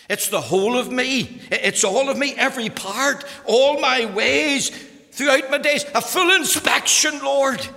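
loud, high, building plea; preaching at full force. Acted. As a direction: build each phrase into a fervent climax.

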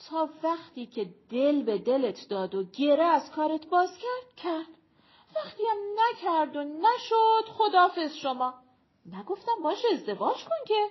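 lightly voiced character, high and loud; animated young woman. Woman arguing with her friend about marriage, animated.

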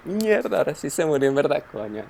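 mock shocked dismay. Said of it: "Y yo".

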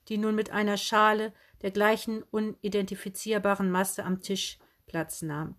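ordinary delivery, nothing marked

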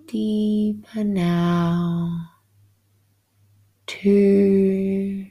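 ordinary delivery

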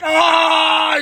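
long, high, loud mock wail; retold scream of pain. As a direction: howl as it squeezes tighter. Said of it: "He's fucking squeezing tighter".

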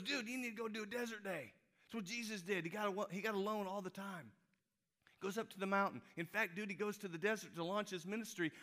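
high-pitched, worked-up buddy impression; imitating an excitable preacher friend; Slim's excitable voice. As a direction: urge your pal on in a high, insistent voice. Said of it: "That's how he talks".

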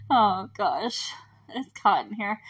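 ordinary talk

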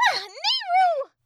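very high, loud fairy shriek; Tattle. A narrator's reading. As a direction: cry out in alarm, squeaky and drawn out. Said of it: "Tattle exclaimed".

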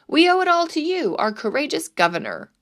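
plain reading voice

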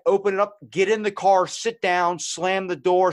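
loud, high, bossy barking orders; loud bossy drunk. Retold or imitated. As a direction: shout the orders at the driver. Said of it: "more like".

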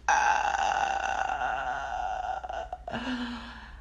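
low, shaky nervous laugh. Real, not acted. Remembering the girl's confession, a shaky nervous laugh. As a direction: laugh it off in disbelief.

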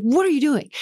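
an understated exasperated yell; retold yell. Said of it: "I yelled at her".

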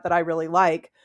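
normal talking voice

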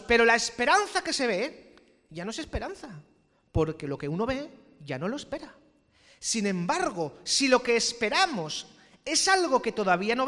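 loud, slow, soaring pulpit delivery; preaching at full force. Acted. Pastor thundering the verse to the congregation, full preaching. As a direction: proclaim the verse to the whole church.

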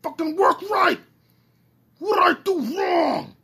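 high, loud whiny shouts; near-crying frustration; frustrated yelling. Real, not acted. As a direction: yell at the broken phone. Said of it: "listen to me complain".

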